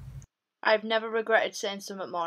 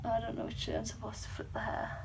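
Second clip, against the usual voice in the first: usual speaking voice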